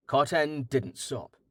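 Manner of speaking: ordinary delivery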